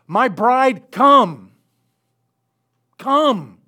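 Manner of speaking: high, loud summoning call; Christ calling his bride. Acted. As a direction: call her home from the sky. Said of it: "when he descends and says".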